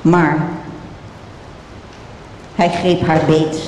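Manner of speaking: ordinary delivery, nothing marked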